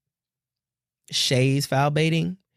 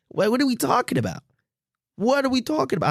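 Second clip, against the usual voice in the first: lightly put-on, higher exasperated movie-star delivery; a hint of a New York accent, pitched up; Denzel Washington; 7 semitones higher, faster than usual. Podcaster mocking foul calls like an actor, exasperated impression. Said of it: "I feel like Denzel Washington".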